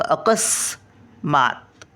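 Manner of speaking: अकस्मात stretched out, स half-said; very slow demo. Shown as a demonstration. Grandmother repeating अकस्मात very slowly for the children. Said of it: "सचा उच्चार अर्धा येतो बघा".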